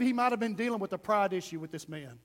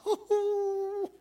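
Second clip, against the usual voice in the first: high, drawn-out stifled giggle; mock giggle; 8 semitones higher than usual. Disciples at the back watching the spit, mock giggling. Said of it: "like, giggling".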